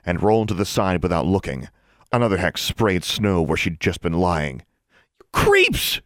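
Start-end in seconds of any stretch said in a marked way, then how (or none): 5.2-6.0 high, loud furious yell. A narrator's reading. Alexandra yells at the boys, furious. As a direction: yell it at the bullies. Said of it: "she yelled".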